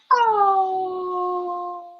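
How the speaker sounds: high, very slow, doting; doting, drawn out and high; tender awe. Retold or imitated. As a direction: linger over it like a proud mom. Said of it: "I was just like".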